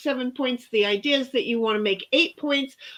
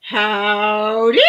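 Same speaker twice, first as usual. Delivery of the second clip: cowboy howdy; 4 semitones lower, 6 dB louder than usual. Retold or imitated. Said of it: "Wendy's like".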